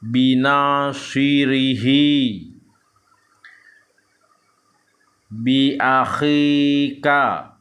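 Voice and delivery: slow, drawn-out Arabic syllables on an even tone; measured Quran-reading demonstration; Iqra recitation drill. Shown as a demonstration. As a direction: chant each syllable long and steady. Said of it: "ini dipanjangkan bibirnya".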